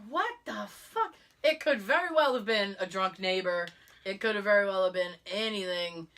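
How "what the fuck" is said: slow puzzled what the fuck; confused, drawn out; bewildered. Retold or imitated. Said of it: "And I was like".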